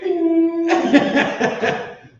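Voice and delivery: loud, very high laugh; real burst of high laughter. Real, not acted. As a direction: crack up loudly, pitch way up. Cracks up mid-rant.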